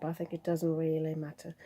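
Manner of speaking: hoarse from a cold; croaky, a bit rough. Real, not acted. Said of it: "apologies for the croaky voice now".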